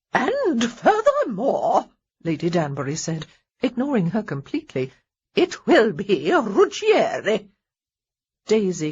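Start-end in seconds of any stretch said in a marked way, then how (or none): 0.1-1.9 slow, high, louder imperious voice; overbearing dowager. A narrator's reading. Lady Danbury overriding Honoria. 5.3-7.5 slow, high, louder imperious voice; overbearing dowager. A narrator's reading. Lady Danbury overriding Honoria.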